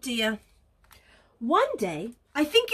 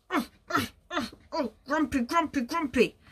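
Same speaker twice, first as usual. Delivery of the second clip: pretend grumpy. Demonstrates grumpy stomping along.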